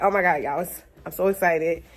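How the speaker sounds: ordinary delivery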